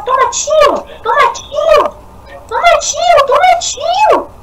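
high squealed taunt, drawn out at the end; high-pitched battle cry. Real, not acted. Gamer firing on an enemy, high-pitched battle cry. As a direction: squeal it as you fire at the enemy.

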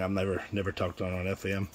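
usual speaking voice

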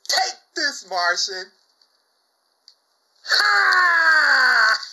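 playful taunt. Pretend. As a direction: tease your friend with a gloating jab. Playful taunt to fellow collector.

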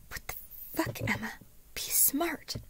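urgent whispered reproach; hushed scolding. A narrator's reading. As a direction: scold under your breath, out of a child's hearing. Angry aside away from the boy.

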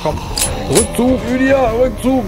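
high loud call to retreat; urgent call. Real, not acted. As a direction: shout the retreat order. Calling retreat to teammate.

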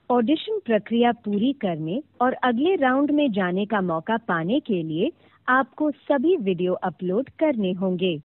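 ordinary talk

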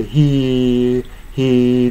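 "he" for "here", one long held "hee"; single vowel. Shown as a demonstration. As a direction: hold a pure ee with no glide or r in "he". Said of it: "単母音に変えようとします".

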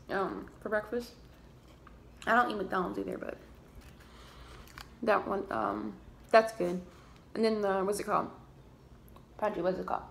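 chewing between words; talking while eating. Real, not acted. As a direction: food near lips. Seen 2: Holds bitten burger at mouth throughout speech; likely chewing between bites.

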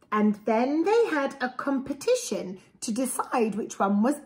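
lively, sing-song storybook read; reading to children. A narrator's reading. Parent reading a picture book to a child, lively storybook voice. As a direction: read it aloud to little ones with relish.

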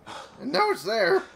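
frustrated insistence, voice breaks; voice cracking. Real, not acted. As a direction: voice cracks up high, slowed. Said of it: "I don't know why my voice cracked".

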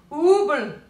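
high, loud gummed-up mumble; mouth full of toffee. A narrator's reading. Moonface shaking his head, mouth full of toffee. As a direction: mumble through stuck teeth. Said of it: "unable to speak properly".

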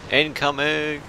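high, sharp warning call; incoming call. Real, not acted. Calling out enemy fire.

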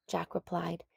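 plain reading voice

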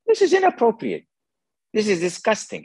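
high-pitched mock indignation; offended woman. Woman objecting to the sheikh's touching proposal, scandalized. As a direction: imitate her scandalized protest.

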